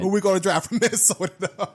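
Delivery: laughing through a put-on Minnesotan; playful, hammed-up Minnesota accent; mock Minnesota accent. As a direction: tease in a fake Minnesota accent.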